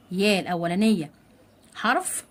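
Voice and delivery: normal talking voice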